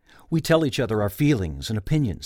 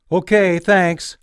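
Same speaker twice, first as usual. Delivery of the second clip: loud, slow, higher call up the stairs; calling back; 8 semitones higher, 8 dB louder, slower than usual. Retold or imitated. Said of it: "I called back".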